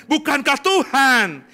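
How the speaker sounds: emphatic proclamation. Acted. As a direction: ring it out to the congregation. Preacher repeats the quoted verse. (seruan lantang penuh penekanan)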